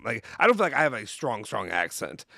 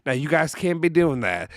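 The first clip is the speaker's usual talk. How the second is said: "you can't be doing that" drawn out slowly in a mock Southern accent; imitating the governor's slow Southern scolding; 3 semitones higher than usual. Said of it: "like, Southern accent".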